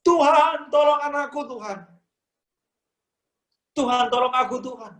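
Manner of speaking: acted desperate shout; desperate cry for help. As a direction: cry out to the Lord like a mother begging for her child. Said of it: "Mungkin dia teriak".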